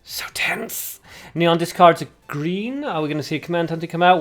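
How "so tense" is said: high, hushed whisper; breath-only aside at a nail-biting moment; tense whisper. Real, not acted. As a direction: whisper it in suspense. Commentator reacting to a close match.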